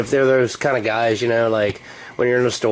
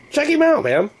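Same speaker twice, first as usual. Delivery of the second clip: excited; 4 dB louder than usual. Real, not acted. Bug reappears.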